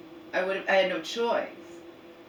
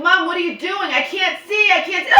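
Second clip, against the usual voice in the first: exasperated shout; 10 semitones higher, 8 dB louder than usual. Retold or imitated. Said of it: "I'm like".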